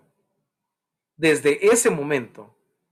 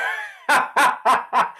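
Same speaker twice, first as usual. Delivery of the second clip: breathy laugh; 10 semitones higher than usual. Real, not acted. Pastor laughs at his own joke.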